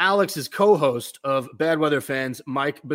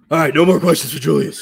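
loud coach impersonation shutting it down; Tibbs; 9 dB louder than usual. Acted. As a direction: cut off questions in a booming coach's voice. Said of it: "that's the Tibbs voice".

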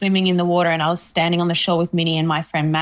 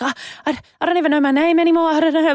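flustered fumbling; 8 semitones higher than usual. Retold or imitated. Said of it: "You kept fumbling on the phone".

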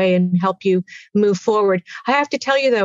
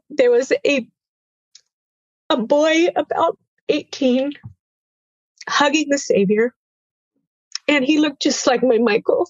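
tearful, real; 5 semitones higher than usual. Real, not acted. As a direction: voice lifting with held-back tears.

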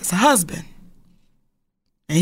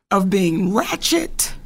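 ordinary talk, nothing marked

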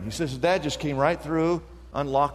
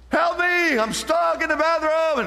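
put-on child crying for help; 10 semitones higher than usual.

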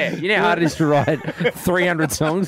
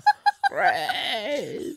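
high laughing cry; 14 semitones higher, 4 dB quieter than usual. Real, not acted.

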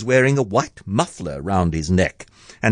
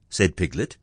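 ordinary delivery

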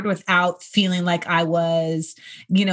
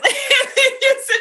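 high laugh; 4 dB louder than usual. Real, not acted. After caller impression.

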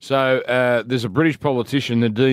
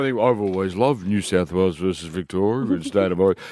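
much lower-pitched mock politician voice; Bob Carr; 8 semitones lower than usual. Host mimicking a politician on state of origin, deep mock voice. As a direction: drop right down into a politician impression. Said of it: "Bob Carr".

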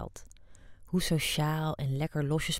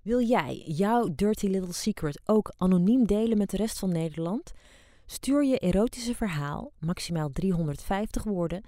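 ad read; 4 semitones higher than usual. Acted. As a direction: invite listeners to send their stories. Magazine call for stories.

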